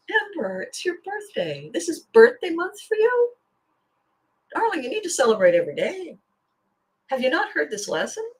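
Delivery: mock fan comment. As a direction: tease yourself as a cheeky commenter would. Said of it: "You're gonna write in the comment".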